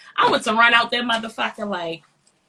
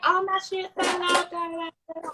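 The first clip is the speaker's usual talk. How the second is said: mocking the lady; 7 semitones higher than usual. Mocking the lady's complaint.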